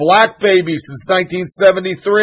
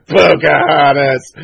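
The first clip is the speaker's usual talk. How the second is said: laughing jab; 3 semitones lower, slower than usual. Real, not acted. Mocking Warren over coin.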